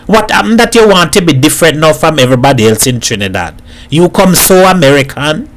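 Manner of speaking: animated Trinidadian neighbour, taking him to task; incredulous street-corner reproach; scolding Hat. Acted. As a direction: chide him in broad Trinidad dialect. Hat scolds Edward about marrying.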